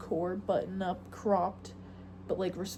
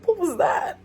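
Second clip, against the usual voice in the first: sudden high, loud startled question; 10 semitones higher, 8 dB louder than usual. Real, not acted. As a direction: jump at the noise. Startled by a noise.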